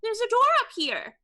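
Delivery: high-pitched call to those below; screaming down. Acted. Player shouts down to the fighting party, high acted yell. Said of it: "I'm just gonna scream down".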